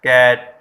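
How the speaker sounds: कैट said out loud with a clear open vowel; demo word, loud. Shown as a demonstration. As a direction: announce कैट as a model word. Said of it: "बड़े ए के symbol में like बैट".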